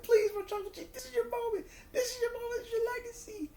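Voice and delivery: very high, tearful begging; pleading Heyman. Retold or imitated. Heyman begs Roman to finish it, high tearful voice. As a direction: cry out the plea like a desperate manager. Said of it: "Heyman was like".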